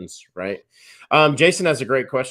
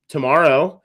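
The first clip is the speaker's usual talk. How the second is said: ordinary delivery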